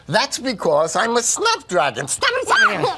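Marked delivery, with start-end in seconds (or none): 2.1-3.0 high playful snap; snapping. Acted. Wolf snaps jaws.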